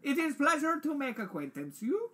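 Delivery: high, hammed-up European accent; bad European accent. Doctor meets the new researcher, exaggerated European accent. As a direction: greet them in a high mock European accent. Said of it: "European accent".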